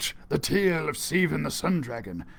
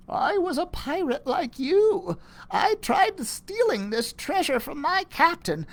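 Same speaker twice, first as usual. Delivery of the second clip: put-on higher old woman's voice; old lady; 11 semitones higher than usual. Old lady confesses to the kids, higher voice. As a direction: voice a cursed old pirate lady. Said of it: "She told us".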